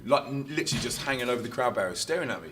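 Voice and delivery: ordinary talk, nothing marked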